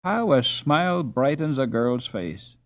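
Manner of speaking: usual speaking voice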